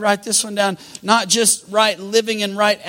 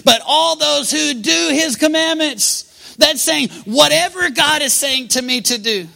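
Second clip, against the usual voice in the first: loud, raised-pitch exhortation; full-force preaching; 6 semitones higher, 5 dB louder than usual. Acted. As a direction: drive the point home to the congregation.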